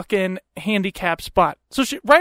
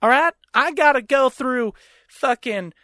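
higher, hammed-up Southern ranting; mock indignant Southerner; 5 semitones higher than usual. Wife refuses husband and rants, mock Southern indignation. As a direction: imitate her digging in, pitched up with a twang.

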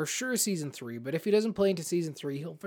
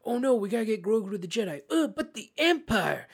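high, hammed-up panic, rising louder; mock drama; 6 semitones higher, 4 dB louder than usual. Fan mocking a dragged-out Grogu plot, hammed-up panic. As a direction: lampoon a stale two-season plot.

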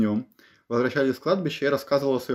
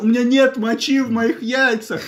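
acted quote; 12 semitones higher, 7 dB louder than usual. Acted quote from funny story.

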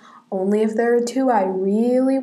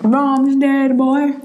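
louder, drawn-out taunt as a mean dad; mock abusive dad; 3 semitones higher, 6 dB louder, much slower than usual. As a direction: play the cruel drunk father, hammed-up. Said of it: "Dad, like, coming home, like, smacking him around".

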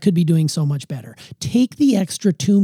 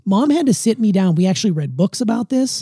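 ordinary talk, nothing marked

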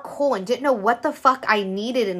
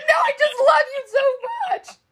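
mock crying. Imitating herself crying to her boyfriend, mock wailing. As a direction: wail it out in an exaggerated tearful voice.

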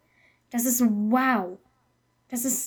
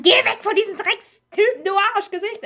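higher, louder mock yell at a villain; yelling at the screen; 8 semitones higher, 6 dB louder than usual. Fan yelling at a film villain, hammed-up shout. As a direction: shout the insult at the TV. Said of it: "Da schrei ich ihn eher immer an".

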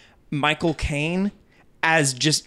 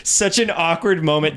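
ordinary talk, nothing marked

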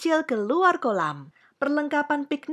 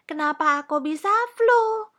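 put-on high, loud child's question; dismayed little princess; 8 semitones higher, 5 dB louder than usual. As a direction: ask like a puzzled little girl. Princess asks doctor in dismay.

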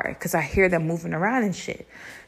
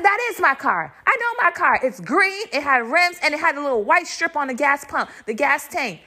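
high-pitched, indignant insistence; insistent arguing; 7 semitones higher than usual. Retold or imitated. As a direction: argue back, pitched well up. Arguing with father over car.

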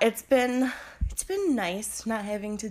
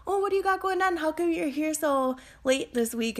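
chatty cashier in a much higher voice; nosy cashier; 7 semitones higher than usual. Retold or imitated. Cashier quizzing the narrator at the checkout, much higher voice. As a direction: pitch up high and pry like a talkative cashier. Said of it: "and she's like".

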